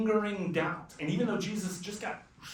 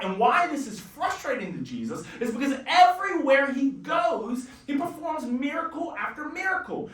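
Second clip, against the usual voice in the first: ordinary talk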